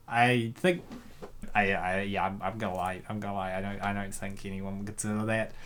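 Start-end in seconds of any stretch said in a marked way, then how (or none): none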